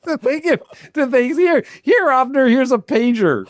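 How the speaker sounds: amused, high-pitched joking; laughing high voice. Real, not acted. Joking, handing over a pager.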